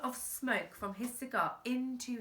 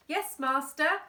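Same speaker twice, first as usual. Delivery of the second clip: servant; 6 semitones higher, 6 dB louder than usual. Acted. Butler answers master.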